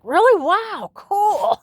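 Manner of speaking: high-pitched, thrilled mock amazement; excited child reaction. Retelling her reaction to dad's hyena story, excited. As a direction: re-enact being wowed, voice leaping up. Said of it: "And I was like".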